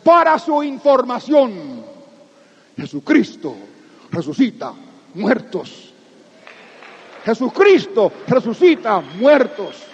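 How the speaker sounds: slow, word by word proclamation; emphatic proclamation. Acted. Preacher closing to the congregation, slow emphatic proclamation. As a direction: stamp each word.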